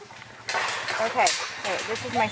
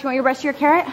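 usual speaking voice

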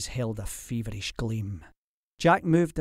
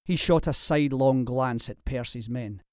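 ordinary delivery

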